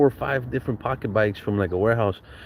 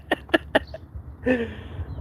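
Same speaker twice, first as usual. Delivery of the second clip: breathy laugh; 13 semitones higher, 5 dB quieter than usual. Real, not acted. Laughing at his own kid voice.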